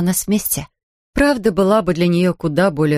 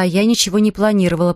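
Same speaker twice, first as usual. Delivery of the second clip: ordinary delivery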